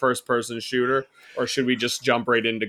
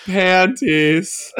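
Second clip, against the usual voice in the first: sexy voice; 5 semitones higher, 4 dB louder than usual. Shown as a demonstration. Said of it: "He'll say it a few times".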